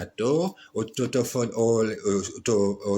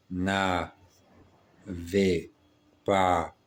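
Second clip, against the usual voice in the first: slow dictation; much slower than usual. Acted.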